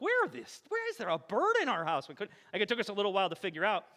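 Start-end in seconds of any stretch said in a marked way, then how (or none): 0.0-2.1 alarmed. Retold or imitated. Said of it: "we're like".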